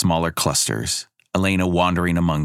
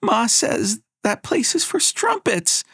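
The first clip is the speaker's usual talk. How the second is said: nearly in tears. A narrator's reading. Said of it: "nearly in tears".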